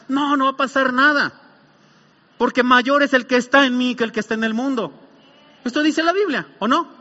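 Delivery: firmly rebut with scriptural conviction; forceful pulpit declaration; impassioned preaching. Acted.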